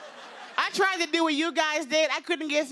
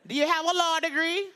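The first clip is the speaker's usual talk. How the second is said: put-on pointed Jamaican-accented question, pitched up; Jamaican mother; 4 semitones higher than usual. Mom interrogating her comedian daughter, Jamaican accent. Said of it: "her very heavy Jamaican accent".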